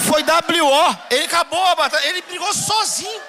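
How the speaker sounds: loud, laughing exclamation; laughing shout. Real, not acted. Pastor laughing to the church, loud. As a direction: crow with laughter about it.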